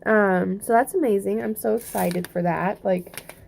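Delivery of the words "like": ordinary talk, nothing marked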